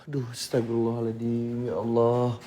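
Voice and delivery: spooked murmur after seeing the window move; hushed startled prayer. Real, not acted. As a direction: gasp a prayer under your breath.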